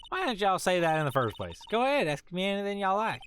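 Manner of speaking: lightly drawled Southern country gal; folksy Southern accent, mild; a hint of a Southern belle accent. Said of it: "the, like, southern voice".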